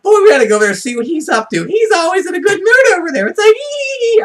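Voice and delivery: high, loud, fast gossipy neighbor; mock the curious lady; hammed-up squeal at the end; neighbor's excited voice. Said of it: "I'm like".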